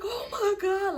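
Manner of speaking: high tearful wail; imitated crying. Drunk girl seeing the shot boy, high mock crying. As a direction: cry out in mock horror. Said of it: "I'm crying even more now".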